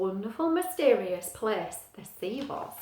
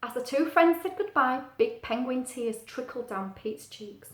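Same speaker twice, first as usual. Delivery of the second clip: ordinary delivery, nothing marked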